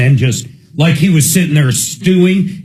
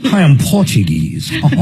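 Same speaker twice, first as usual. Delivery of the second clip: hammed-up Portuguese accent, lightly done; mock Portuguese accent; 2 semitones higher than usual. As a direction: play a cartoonish Portuguese tourist accent. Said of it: "Portuguese accent".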